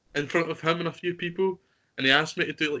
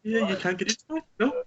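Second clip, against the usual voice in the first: high, chuckling New Zealand accent impression; put-on New Zealand accent with a laugh; Korg, New Zealand accent; 9 semitones higher, 5 dB louder than usual. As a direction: imitate a New Zealander in a higher-pitched voice. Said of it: "just copying Korg".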